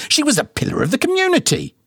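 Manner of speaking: high-pitched, huffy mother voice; indignant mama. A narrator's reading. Mama bickering with daddy about his bald spot, high and huffy.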